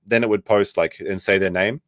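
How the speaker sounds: ordinary talk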